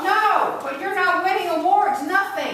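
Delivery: furious scornful shout. Acted. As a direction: yell it right at him. Character refuses and attacks.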